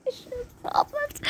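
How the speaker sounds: high-pitched, whiny mock sob rising as it goes; climbing into a put-on tearful squeal; mock crying. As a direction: wail it like you're about to cry. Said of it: "I wanna cry right now".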